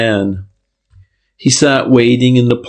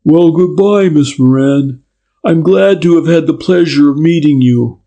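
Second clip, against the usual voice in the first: loud, higher public goodbye for eavesdroppers; loudly; 6 semitones higher than usual. A narrator's reading. Detective bids the girl goodbye for eavesdroppers, loudly. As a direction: say it out for anyone listening. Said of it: "Then loudly, for the benefit".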